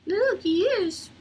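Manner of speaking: high, slow cooing to a puppy. Real, not acted. Showing the new puppy.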